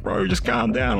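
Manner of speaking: high-pitched, agitated calming plea; urgent pleading. Retold or imitated. Mate struggling to hold him back, urgent pleading. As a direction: pitch it well up, restraining a friend.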